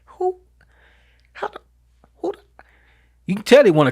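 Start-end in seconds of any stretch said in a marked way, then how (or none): 0.0-2.4 half-whispered shock, then a high squeak; mock a kid choking back a cuss; startled broken-off outrage; stunned stammer. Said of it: "Johnny's face is like".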